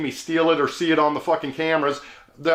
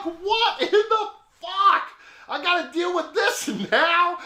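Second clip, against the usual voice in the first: very high, drawn-out disbelief breaking into a laugh; incredulous outcry; 13 semitones higher, slower than usual. Retold or imitated. Stranded driver seeing the engine on fire, incredulous. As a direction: squeal it slowly in comic dismay.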